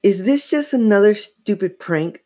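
high-pitched angry question. A narrator's reading. Mother suspecting another prank, angry. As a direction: demand an answer crossly. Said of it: "Missus Powell asked angrily".

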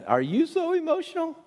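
very high, playful mock question; high singsong teasing. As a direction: poke fun at her gently. Teasing the daughter.

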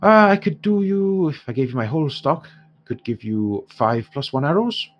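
put-on haggling dwarf merchant in an Irish accent; dwarf shopkeeper in an Irish accent haggling with the heroes. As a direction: bargain in a lilting Irish dwarf voice.

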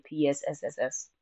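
long hissing snake sound; drawn-out s like a snake. Acted. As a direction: hiss it out like a snake. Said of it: "as you would like to say".

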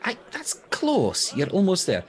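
high, bright-voiced cheering on; encouraging praise. Real, not acted. Coaching a student kindly.

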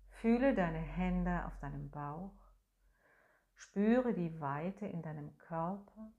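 ordinary talk, nothing marked

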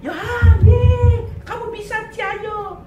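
high, slow, bouncy cheer; mock peppy cheerleading dad; hammed-up cheerleader father cheering his daughter on. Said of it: "bapak itu bukan cheerleader".

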